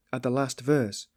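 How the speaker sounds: plain reading voice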